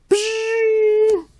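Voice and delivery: high hissing zap sound; magic blast noise. Acted. Effect for the sorceress blocking Skeletor.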